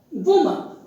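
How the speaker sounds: "woman" said WUU-mun, weak second vowel; correct pronunciation. Shown as a demonstration. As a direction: say "woman" with short U, then a schwa. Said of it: "the correct pronunciation is".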